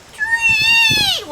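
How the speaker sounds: high, loud and slow; stretched sound word. A narrator's reading. Reading to kids as the toad leaps, drawn-out excited call.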